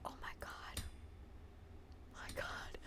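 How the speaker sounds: barely-there whispered dismay; stunned whisper. Real, not acted. Streamer whispering in horror at the mistake. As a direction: whisper it, horrified. Stunned after accidental ban.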